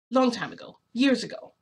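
high, loud, dropping off on each phrase; insistent and defensive, each phrase punched; emphatic dismissal. Retold or imitated. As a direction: brush it off as ancient history. Retold emphatic disowning of tale.

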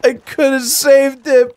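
overacted sorrow over a dead buddy; hammed-up grief. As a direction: drag it out like a tragic hero. Mourning a dead ally.

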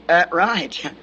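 put-on low, mild grunt of an old chief; a hint of a terse old Indian; lightly voiced old Indian. Preacher retelling the old chief's answer, lightly voiced low. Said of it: "this old Indian".

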